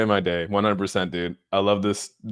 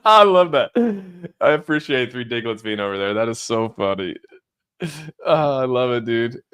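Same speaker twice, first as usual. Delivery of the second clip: usual speaking voice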